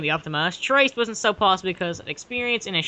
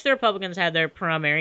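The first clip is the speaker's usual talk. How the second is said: usual speaking voice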